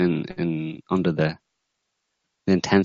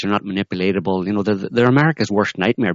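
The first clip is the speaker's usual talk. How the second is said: ordinary talk, nothing marked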